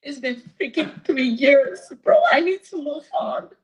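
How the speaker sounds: high, crying, real exasperation; tearful plea. Real, not acted. Woman begging to move on after three years, tearful. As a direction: plead through real tears. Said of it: "I can't get with the tears right now".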